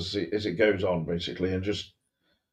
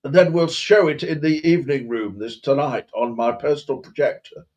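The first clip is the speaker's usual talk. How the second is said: high, loud posh indignation, settling as it goes; hammed-up affronted posh English gentleman; faux posh outrage; 6 semitones higher, 5 dB louder than usual. Posh master ordering the boy to polish silver, mock indignant. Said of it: "but faux posh".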